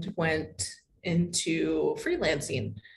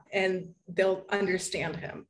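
ordinary talk, nothing marked

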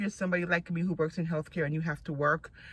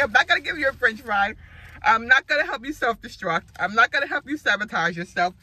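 loud, raised voice imitating an insistent son; mock scolding; 6 semitones higher, 7 dB louder than usual. Son refusing mom a French fry, mock scolding. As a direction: lay down the law in a playful retelling, high and loud.

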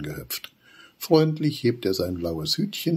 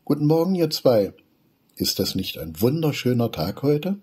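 much higher, rising morning hello; bright child greeting; 8 semitones higher, 5 dB louder than usual. Acted. Little Wudi cheerfully greeting Papa and Opa, high and bright. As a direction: greet them with a cheerful lift.

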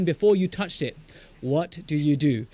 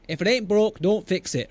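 put-on Yorkshire accent, pitched up; 4 semitones higher than usual. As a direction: quote it in your best broad Yorkshire. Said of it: "That's my best Yorkshire accent".

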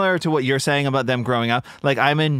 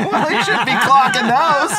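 laughing retort; 12 semitones higher, 5 dB louder than usual. Retold or imitated. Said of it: "And I was like".

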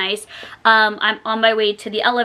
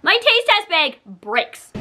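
ordinary talk, nothing marked

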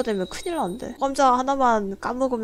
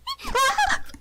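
high squeaky laugh. Real, not acted. Laugh after scare.

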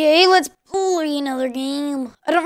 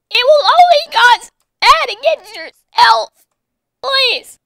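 high, hammed-up begging that climbs higher and louder; strained pleading voice; 7 semitones higher, 4 dB louder than usual. Gamer begging an opponent not to hurt him, high strained mock pleading. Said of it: "Why am I talking like this? It hurts my voice even worse".

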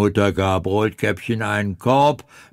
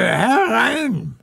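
calling out; 10 semitones higher than usual. Acted. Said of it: "rief die Alte".

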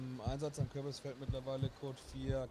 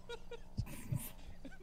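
high, breathy burst of laughter; high unvoiced giggle of relief; nervous laugh; 6 dB quieter than usual. Real, not acted. Laugh after near crash.

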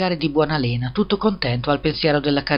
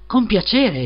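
exclaiming; 7 semitones higher, 3 dB louder, slower than usual. A narrator's reading. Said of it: "esclamò il piccolo Hans".

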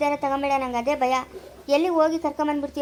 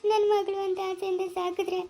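tearful; 3 semitones higher than usual. Acted. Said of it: "ನೀನು ಅಳ್ಬೇಡ".

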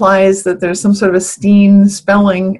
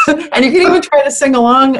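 laughing; 5 semitones higher than usual. Real, not acted. Laughing about the song.

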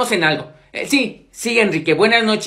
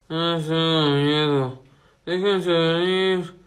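low, slow sleepy murmur; soft, drowsy drawl down low; sleep-talking; 8 semitones lower, 6 dB quieter, slower than usual. Acted. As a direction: murmur slowly from deep in a dream.

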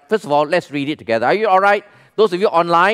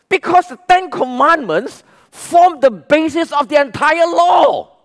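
rising to a shout on entire law; preaching shout; 9 semitones higher, 3 dB louder than usual. Real, not acted. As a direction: drive it home to the congregation. Preacher stressing the point.

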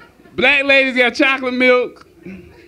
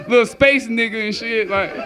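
ordinary talk, nothing marked

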